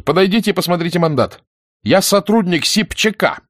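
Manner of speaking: put-on high-pitched, forceful young woman; authoritative command. A narrator's reading. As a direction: assert your rank, pitched well up. Nina asserts authority. (властно, высоким голосом)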